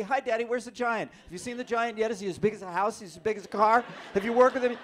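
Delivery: put-on high, loud, eager kid questions; excited child voice. Child on the phone begging daddy for giant news, high and eager. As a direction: bounce with a little kid's excitement.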